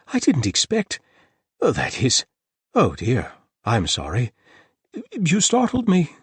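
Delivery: high, loud startled babble; stumbling over his words; flustered apology. A narrator's reading. The host apologizing after the cat startles him, flustered.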